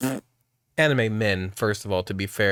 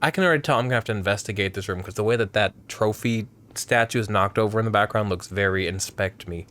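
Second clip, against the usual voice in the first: ordinary talk, nothing marked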